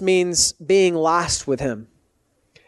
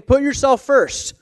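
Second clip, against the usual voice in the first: high, pleading tempter voice; 5 semitones higher than usual. Retold or imitated. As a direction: coax him like a tempter. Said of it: "Peter was essentially saying".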